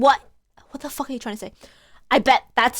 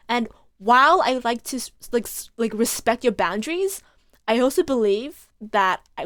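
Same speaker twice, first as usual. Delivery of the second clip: usual speaking voice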